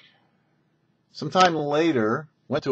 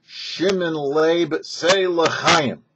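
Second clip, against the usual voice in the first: slow, raised, loud call to drink; urging toast; 5 semitones higher, 4 dB louder, much slower than usual. Retold or imitated. The Rebbe urges the dying man to drink, loud and raised. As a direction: draw out the name, then push the toast.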